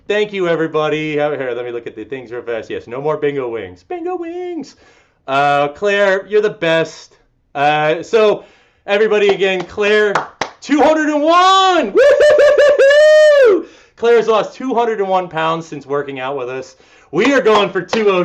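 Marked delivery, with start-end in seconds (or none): 3.8-4.8 "Bingo wings" echoed much higher in a playful squeal. Acted. Coach echoing a viewer's comment, "Bingo wings" in a high teasing voice. Repeats phrase mockingly higher. 10.6-13.7 loud, high, drawn-out celebratory whoop; excited cheer. Real, not acted. Coach celebrating a member's two hundred pound loss, whooping loud.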